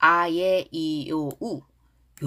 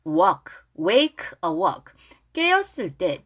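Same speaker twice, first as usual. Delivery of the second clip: ordinary talk, nothing marked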